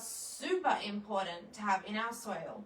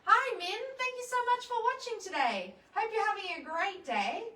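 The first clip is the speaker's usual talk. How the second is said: bright greeting; 9 semitones higher, 4 dB louder, faster than usual. Real, not acted. Greets a viewer on livestream.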